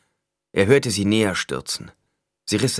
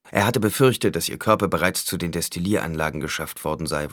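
ordinary delivery

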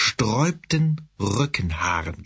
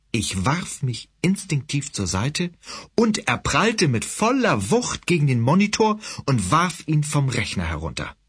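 usual speaking voice

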